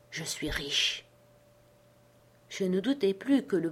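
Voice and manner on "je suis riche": low, soft secret whisper. A narrator's reading. Castaway confiding his wealth to Jim, hushed whisper. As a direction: lean in and breathe the secret. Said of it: "me dit dans un chuchotement".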